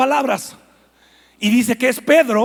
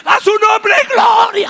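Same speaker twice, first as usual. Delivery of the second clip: jubilant shout; 11 semitones higher, 7 dB louder, slower than usual. Acted. Fish proclaiming glory.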